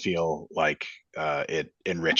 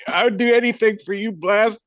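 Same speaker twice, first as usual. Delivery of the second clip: put-on much higher, louder drunken pledge; drunk slurring; 6 dB louder than usual. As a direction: slur a loyal drunk oath. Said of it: "slurring her words pretty badly".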